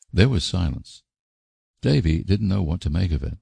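plain reading voice

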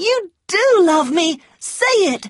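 high, loud and fast, pressing him; eager insistence. A narrator's reading. Scarlet pressing Ashley to admit his love, fast and high. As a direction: rush it out high, demanding he say it. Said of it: "she cried".

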